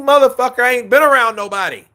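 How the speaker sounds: loud, high, fed-up comeback; indignant retort. Retold or imitated. Answering people who nag about social distancing, indignant. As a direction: protest it, high and exasperated.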